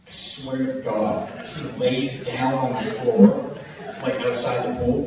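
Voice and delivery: ordinary talk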